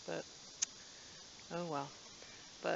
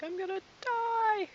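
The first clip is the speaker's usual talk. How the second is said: imagined panic; 3 dB louder than usual. Pretend. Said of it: "I'd be like".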